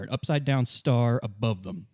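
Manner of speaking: ordinary talk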